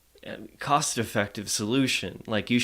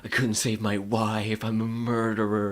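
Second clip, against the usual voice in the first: mock brooding; 2 semitones lower than usual. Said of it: "brooding about".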